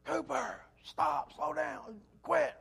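high, slow yelled call after a runaway dog; calling the dog to stop, pitch way up; retold yell at dog. Owner yelling at his runaway dachshund, high strained call. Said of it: "I was yelling at him".

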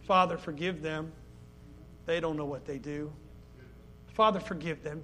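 hushed tender plea. Acted. Preacher quoting Jesus on the cross, hushed and tender. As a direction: breathe it out as gentle forgiveness.

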